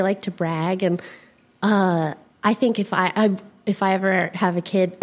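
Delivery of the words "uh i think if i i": ordinary talk, nothing marked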